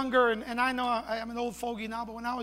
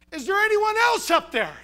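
high, loud hopeful call; comic cry for another rescuer; man calling upward; 10 semitones higher, 5 dB louder than usual. Acted. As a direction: call up past God for someone else. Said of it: "The man's response after a minute was".

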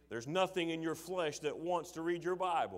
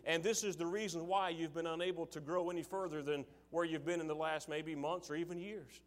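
ordinary delivery

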